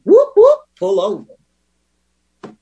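high loud siren whoop then a slow drawn-out pull over; imitated police siren. Recovering nurse mimics the police siren. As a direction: mimic the cop car's siren.

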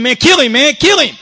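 high mock yell from the sidelines; screaming onlookers.

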